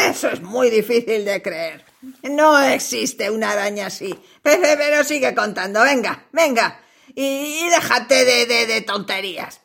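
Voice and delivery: low, menacing spider voice; a big hungry spider toying with its prey. Acted. Spider doubts the story.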